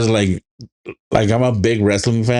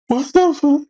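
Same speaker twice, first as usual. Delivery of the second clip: high shocked disbelief. Retold or imitated. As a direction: squeal it in horror, very high. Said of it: "And it's like".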